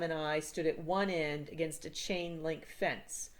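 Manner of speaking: usual speaking voice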